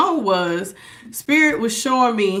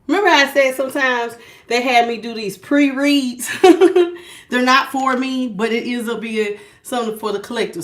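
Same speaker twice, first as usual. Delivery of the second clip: normal talking voice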